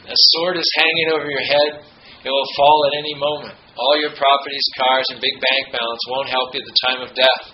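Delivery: firm sermon reading; preaching quote reading. Acted. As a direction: deliver the master's warning to the congregation. Reads Prabhupada quote aloud.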